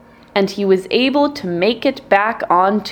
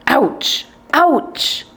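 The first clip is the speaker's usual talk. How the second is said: hunter yelp; 5 semitones higher than usual. A narrator's reading. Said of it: "The screaming startled the bird".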